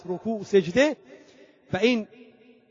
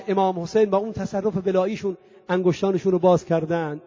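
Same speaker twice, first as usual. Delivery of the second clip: normal talking voice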